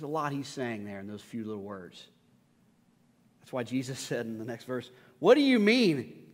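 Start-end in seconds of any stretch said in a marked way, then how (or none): 5.2-6.3 raised, louder, slow challenge; acted indignant question; challenging. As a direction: question him pointedly, drawing it out. Acts Jesus challenging the father.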